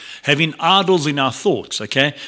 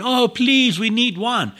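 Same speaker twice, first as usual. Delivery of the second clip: high, pleading mock whine; Mary's imagined nagging plea to Jesus, high and wheedling; 9 semitones higher, slower than usual. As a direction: play the nagging wife begging, hammed up. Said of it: "She doesn't nag".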